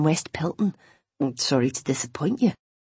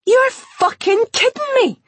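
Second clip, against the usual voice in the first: much higher, louder, slow excited yell; 8 dB louder than usual. Acted. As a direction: scream it jumping for joy. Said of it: "Jenny yelled".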